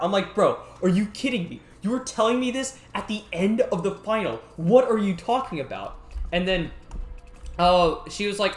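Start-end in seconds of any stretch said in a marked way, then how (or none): 0.3-6.0 incredulous outburst. Retold or imitated. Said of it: "ready to just shout at her".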